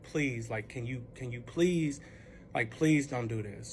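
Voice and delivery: low, soft, slow please; subdued retold begging; calmed-down pleading. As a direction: plead quietly, voice dropped. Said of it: "calmed down".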